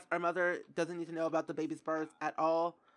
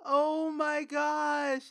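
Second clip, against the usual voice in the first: tearful sigh; 7 semitones higher, 4 dB louder, much slower than usual. Real, not acted. Still grieving the pet.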